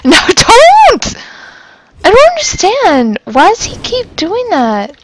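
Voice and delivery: high, loud wail after a laugh; exasperated wail. Real, not acted. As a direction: cry out in helpless protest, pitch way up. Repeatedly attacked by game enemy.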